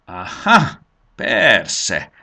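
loud, scornful hiss. A narrator's reading. Old dragon scorns the boy's explanation, hissing. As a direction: hiss it in disbelief. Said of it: "sziszegte Botor".

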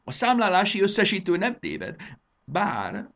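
loud, high-pitched tirade; frantic theatrical outrage; raging lament. Acted. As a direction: rant as if disaster has struck. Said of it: "dühöngött".

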